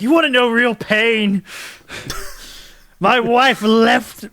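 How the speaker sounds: high, loud melodramatic wrestler on the mic; hammed-up tortured outburst; anguished wrestler. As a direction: wail slowly in mock agony. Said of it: "he grabs the mic and he's like".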